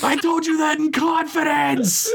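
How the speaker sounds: very high-pitched betrayed shout; strained, comic high yell; shouted outburst. A narrator's reading. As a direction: shout it like a secret just got blurted. Outburst in acted quarrel.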